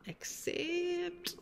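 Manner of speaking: high put-on mantra voice; mock guru voice. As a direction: intone it like a mock sage.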